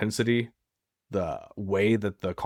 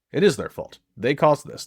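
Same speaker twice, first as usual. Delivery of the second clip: louder and higher, pressing each word; firm emphasis; 5 semitones higher, 5 dB louder than usual. Real, not acted. Firm emphasis, telling the betrayed partner whose fault it is. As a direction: insist the fault is theirs. Therapist stressing who is to blame.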